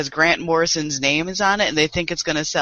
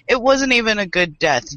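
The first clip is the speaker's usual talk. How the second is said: ordinary delivery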